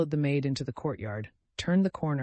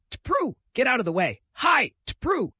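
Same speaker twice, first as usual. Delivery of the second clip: high, louder driver's yells; coachman's shouts; 10 semitones higher, 5 dB louder than usual. A narrator's reading. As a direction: yell at the crowd from the sleigh. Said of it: "The shouting of Balaga".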